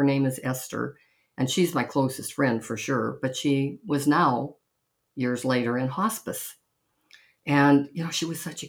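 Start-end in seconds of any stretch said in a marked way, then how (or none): none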